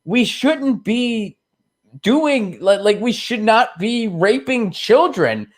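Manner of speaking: high, slow, pleading good priest; high earnest priest. Retold or imitated. Priest pleading with the Vatican, high and slow. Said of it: "and he goes".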